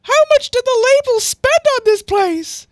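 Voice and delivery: very high, loud squeaky question; C C's voice. Acted. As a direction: squawk it out in falsetto. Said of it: "I forget what C C's voice is".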